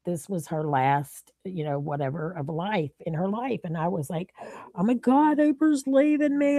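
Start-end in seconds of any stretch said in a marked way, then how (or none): 4.8-6.6 high-pitched mock panic; retold freak-out. Caller fearing her friend is leaving, freaking out. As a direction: re-enact your own panic, pitch jumping up. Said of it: "just literally freaking out".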